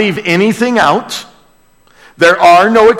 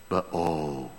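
much softer and lower, reverent; hushed awe; 12 semitones lower, 15 dB quieter than usual. Real, not acted. Preacher echoes Narnia to the congregation, hushed. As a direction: breathe it as a holy secret.

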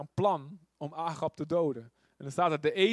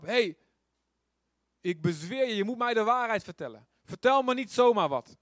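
rising louder to a higher plea; demanding king; 4 semitones higher, 3 dB louder than usual. Retold or imitated. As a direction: press him hard for the truth. King Agab demands the truth. (eisend en steeds luider)

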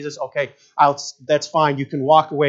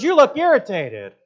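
put-on much higher, louder, slow squeaky child; little girl voice; 12 semitones higher, 8 dB louder than usual. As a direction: play the blunt little girl even higher. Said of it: "She goes".

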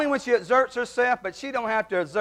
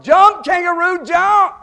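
high, loud schoolyard chant; kids hollering; 10 semitones higher, 9 dB louder, slower than usual. Retold or imitated. School kids hollering at the kangaroo, loud high chant. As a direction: shout it up like excited kids at the zoo. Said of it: "started hollering".

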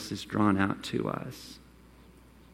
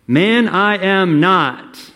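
slow, loud, higher insistence; forceful denial; 8 semitones higher, 9 dB louder, much slower than usual. Acted. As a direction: deny it hard, word by word. Peter's flat denial to accuser.

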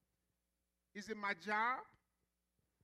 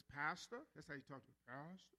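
slow-talking therapist; 6 semitones lower, 6 dB quieter than usual. Retold or imitated. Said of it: "He talked real slow".

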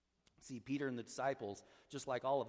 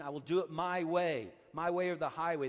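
loud, raised declaration of defiance; loud, willful insistence; stubborn self-will; 4 semitones higher, 7 dB louder than usual. Acted. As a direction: dig in and insist on your way.

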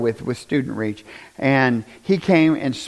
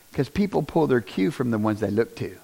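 ordinary delivery

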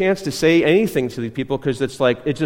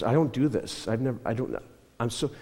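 soft, broken-off phrases, lightly acted; retold halting, tearful voice; 3 semitones lower, 9 dB quieter than usual. Homeless man struggles to answer, soft halting retelling. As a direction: quietly stumble as the upset man. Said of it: "He started crying".

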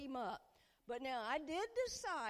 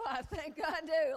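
gushing confession; 5 semitones higher, 8 dB louder than usual. Retold or imitated. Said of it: "Dear Lord, I think I do".

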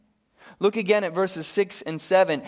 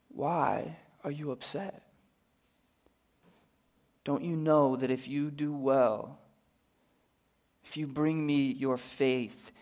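much softer, gentle question; tender invitation; 4 semitones lower, 9 dB quieter than usual. Acted. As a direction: invite them in quietly. God's words to Cain, now to us.

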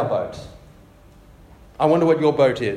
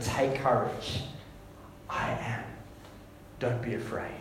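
whispered reassurance; 6 semitones lower, 12 dB quieter than usual. Acted. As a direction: breathe it to someone drowning in fear. Whispered Jesus words.

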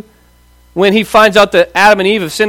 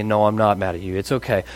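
soothing dad; 10 semitones lower, 9 dB quieter than usual. Retold or imitated. Father soothing his son.

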